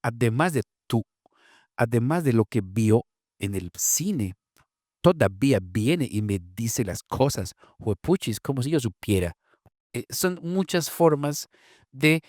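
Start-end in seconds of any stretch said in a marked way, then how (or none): 0.0-9.3 hard stopped d in "Además" and "todavía"; lower-pitched Yucatecan talk with hard stops in "todavía viene"; hard occlusive B, D, G. Shown as a demonstration. Linguist imitating how Yucatecans say it, hard B, D, G. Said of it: "muchos de ellos lo dicen así".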